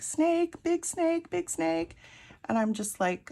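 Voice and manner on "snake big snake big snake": high panicked repeating; frightened. Retold or imitated. Telling her husband about the snake, high frightened squeals. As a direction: squeal it out, terrified. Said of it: "And I'm like".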